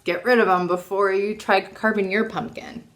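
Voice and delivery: ordinary delivery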